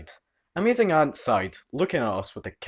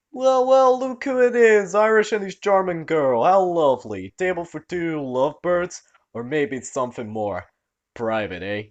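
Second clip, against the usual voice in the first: high, loud hammed-up Italian-American mobster; smarmy wise-guy greeting, overplayed; smug fake mobster greets the couple at the stand; cringy mobster accent; 5 semitones higher, 4 dB louder than usual. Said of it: "his cringy accent".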